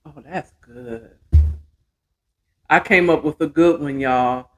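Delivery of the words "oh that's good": savoring sip. Real, not acted. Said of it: "tasting the cocktail".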